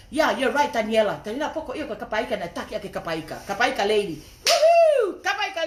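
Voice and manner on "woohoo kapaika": high, loud cheer; bright celebratory woohoo; excited whoop. Real, not acted. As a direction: let out a big whoop of joy. Cheering a commenter on live.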